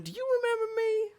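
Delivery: high, quick little cry; squeaky hopeful question. Acted. Merchant hoping the hero recognizes him. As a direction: plead to be remembered.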